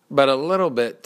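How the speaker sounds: usual speaking voice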